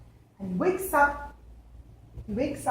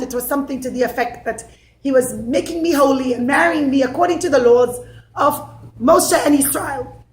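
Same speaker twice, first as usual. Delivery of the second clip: ordinary delivery, nothing marked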